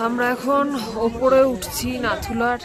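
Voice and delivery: calling out in the cold; loud. Real, not acted. Calling out in rain on the mountain.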